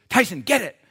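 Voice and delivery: high, loud command to the dog; Angela. Retold or imitated. Preacher imitates wife setting dog on bug, urgent shout. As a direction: imitate an urgent call to a pit bull. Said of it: "all Angela would say was".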